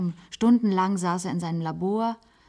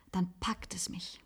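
whisper; 10 dB quieter than usual. Acted. Maggie confesses her longing for the coast in a whisper. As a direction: breathe it like a secret longing.

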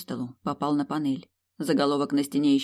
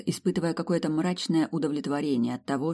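usual speaking voice

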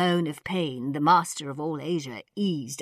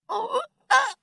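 very high pained yelp; exclaimed cry of pain; 3 dB louder than usual. A narrator's reading. Doctor kneeling painfully, high cry of pain. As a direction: yelp as your back gives out. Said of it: "the doctor exclaimed".

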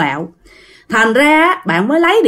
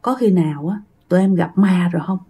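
hushed, lower, hurried frightened stammer; scared blurt; 4 semitones lower, 5 dB quieter, faster than usual. Retold or imitated. Soldier asks his leader if they met a ghost, hushed and scared. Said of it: "một thằng nó mới buột miệng".

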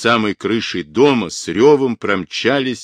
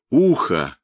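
mildly raised exclamation, pitch leaping on the second phrase; understated alarmed cry; alarmed shout; 6 semitones higher than usual. A narrator's reading. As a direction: cry it out urgently without much volume. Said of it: "в самое лицо ей крикнул он".

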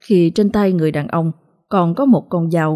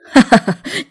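cruel laugh, louder and higher; a killer's cold laughter; evil laugh; 6 semitones higher, 5 dB louder than usual. A narrator's reading. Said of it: "cười lên từng tiếng". (tiếng cười độc ác)

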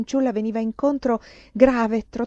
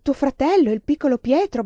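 loud, raised, appalled question; stammering shock; 6 semitones higher, 7 dB louder than usual. A narrator's reading. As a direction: repeat it aghast, voice pitched up.

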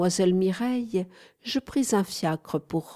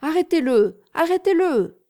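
loud, high shout to the police; high, loud cry of arrêtez-le; 10 semitones higher, 6 dB louder than usual. A narrator's reading. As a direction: yell for the officers to stop him. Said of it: "je leur criai".

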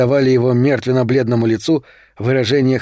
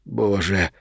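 low, hushed exclamation; soft, low prayer under the breath; whispered plea; 5 semitones lower, 6 dB quieter than usual. A narrator's reading. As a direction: murmur it softly, low in despair. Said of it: "прошептал Палатазин".

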